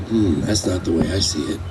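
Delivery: low, soft and unhurried, imitating dad's calm. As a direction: quietly settle the worry, a lightly voiced father. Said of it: "he very calmly".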